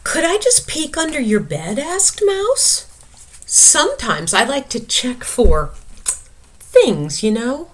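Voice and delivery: high, polite little mouse; small mouse asking politely. Acted. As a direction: ask sweetly like a mouse. Mouse voice in story.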